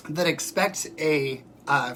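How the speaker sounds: ordinary talk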